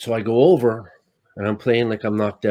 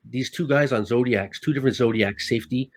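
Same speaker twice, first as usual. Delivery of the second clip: usual speaking voice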